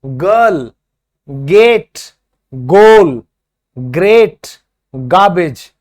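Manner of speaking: crisp word-by-word pronunciation drill; hard g words demo. Shown as a demonstration. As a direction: model the hard ग in each word for the class. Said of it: "तो देखो जी".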